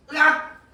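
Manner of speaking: short, loud, high yelp; mock scream. Teacher reacting to student stuck on an easy question, mock scream. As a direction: let out a sudden mock-horrified scream.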